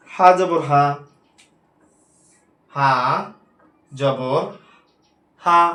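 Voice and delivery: "হা জবর হা" spelled slowly, the last হা short; name the letter, then the quick হা; spelled-out demo. Shown as a demonstration. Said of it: "এই হা উচ্চারণটাই". ("হা জবর হা" বানান করে, শেষের হা ছোট)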